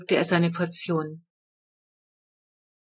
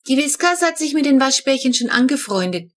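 confident; 6 semitones higher, 4 dB louder than usual. A narrator's reading. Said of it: "verkündete Flo zuversichtlich".

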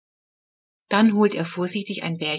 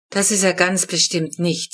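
usual speaking voice

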